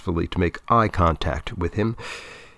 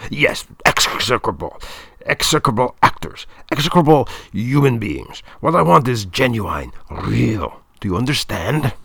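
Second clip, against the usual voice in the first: put-on higher, emphatic German-accented tirade; German director, relishing disdain; 4 semitones higher, 3 dB louder than usual. A narrator's reading. Director pleased with his word, ranting in German accent.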